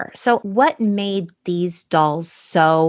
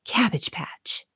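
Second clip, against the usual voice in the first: whispered secret; 7 dB quieter than usual. Shown as a demonstration. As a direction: whisper it like a secret, softer and slow. Secret place in the story.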